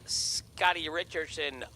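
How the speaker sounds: measured announcer read of racer details; race announcer. Acted. Live drag race calling.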